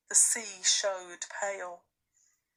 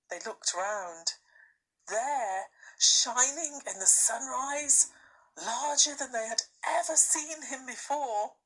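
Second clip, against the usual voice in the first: plain reading voice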